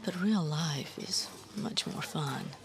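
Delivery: slow, sly whispered reply. Acted. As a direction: tease him in a sly whisper. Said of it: "she replied with a sly grin".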